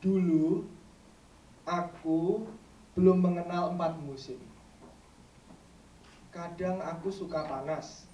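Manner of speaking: measured verse, strong openings and long pauses; poetry recitation. Acted. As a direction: recite it line by line to an audience. Recites second poem.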